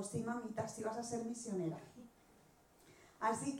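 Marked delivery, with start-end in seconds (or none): 0.0-1.8 quieter, lower quoted reply from God; lightly voiced God. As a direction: softly contradict her, low and sure. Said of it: "Dios me dijo". (voz suave de Dios, apenas imitada)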